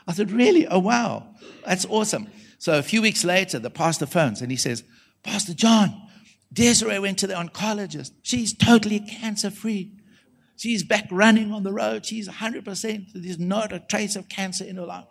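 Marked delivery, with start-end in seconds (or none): 0.3-2.3 high-pitched amazed reaction; acted surprise. Preacher learning she is the son's mom, high and astonished. 5.2-15.1 high, thrilled phone report; excited good news. Retold or imitated. Pastor phoning to report a cancer healing, high and excited.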